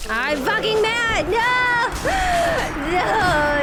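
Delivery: high loud frustrated yell; frustrated outburst. Real, not acted. Streamer losing a fight, frustrated yell. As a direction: vent at the game.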